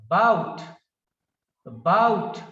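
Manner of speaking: "bout" loud after a weak initial a; stress on second syllable. Shown as a demonstration. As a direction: punch "bout", swallow the a before it. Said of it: "the second syllable is stressed".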